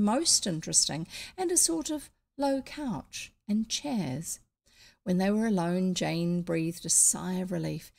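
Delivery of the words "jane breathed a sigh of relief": ordinary delivery, nothing marked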